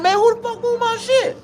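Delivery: high, loud shout of pure outrage; outraged yell. Retold or imitated. Coming home to his belongings thrown outside, high outraged yell. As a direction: yell it like you just found your stuff out on the lawn.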